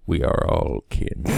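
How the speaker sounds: low, slow sage; wise old man. Acted. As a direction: deliver it slowly like an old sage. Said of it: "to wise old man".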